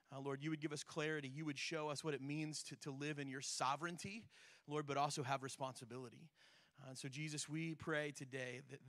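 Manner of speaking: soft, low, earnest prayer voice. Acted. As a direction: pray quietly and sincerely. Opening prayer before the sermon.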